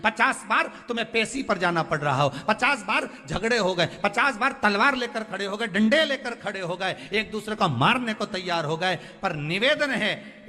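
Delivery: high-pitched, driving sermon appeal; impassioned preaching. Real, not acted. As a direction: pile up the examples with rising urgency. Preaches with raised intensity.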